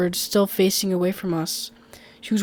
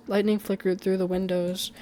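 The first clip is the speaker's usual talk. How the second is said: ordinary delivery, nothing marked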